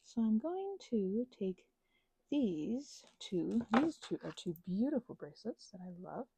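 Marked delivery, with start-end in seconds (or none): none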